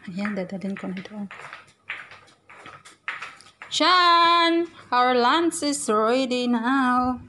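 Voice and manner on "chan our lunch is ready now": yelling across the room. Real, not acted. Calling employer to lunch.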